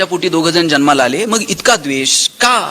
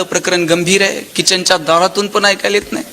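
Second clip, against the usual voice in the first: usual speaking voice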